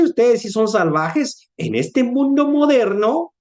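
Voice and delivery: high, slow, scandalized scolding; scandalized lecture. Retold or imitated. Modern ghost to the viking, slow scandalized scolding. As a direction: lecture him as a savage.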